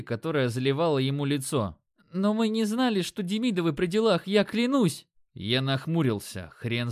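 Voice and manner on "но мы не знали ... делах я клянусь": high, panicked swearing it's true; frightened pleading. A narrator's reading. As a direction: beg in sudden fear, pitched up. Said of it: "дрожащим от страха голосом".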